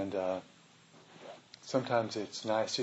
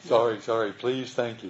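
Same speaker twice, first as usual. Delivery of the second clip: repeated through laughter, louder; laughing mantra; 8 dB louder than usual. Retold or imitated. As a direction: echo the apologetic mantra while laughing. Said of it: "repeats the mantra laughing".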